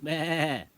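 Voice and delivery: high, loud imitation goat bleat. Calling to a loose goat, bleating. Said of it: "And I went".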